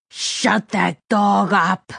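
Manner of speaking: slow, forceful, every word leaned on; angry villain order. A narrator's reading. Gunman grabbing captive by the collar, angry order. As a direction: repeat the order with rising anger, very slowly.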